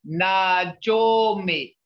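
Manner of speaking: Najomi spelled out slowly; Najomi, each syllable stretched and clear; slow syllable model. Shown as a demonstration.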